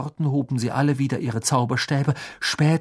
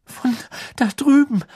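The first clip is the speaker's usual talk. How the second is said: high, frightened, hesitant voice; timid, high-pitched reply; trembling; 11 semitones higher than usual. A narrator's reading. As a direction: answer haltingly, trembling with fear. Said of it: "sagte Hermine zitternd".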